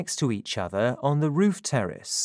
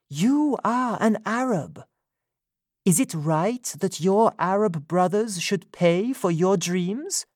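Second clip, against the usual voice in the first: Sophie's voice; 8 semitones higher than usual. A narrator's reading. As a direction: speak up as her, indignant. Sophie challenging Freddy.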